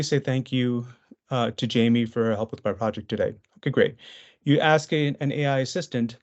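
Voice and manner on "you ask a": ordinary delivery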